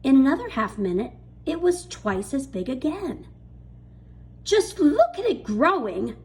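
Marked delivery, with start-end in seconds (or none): 4.4-6.1 high, loud, thrilled aunt; excited cry. A narrator's reading. Aunt Spiker marvelling at the growing peach, excited cry. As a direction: cry it out in wonder at the fruit. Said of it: "Aunt Spiker cried".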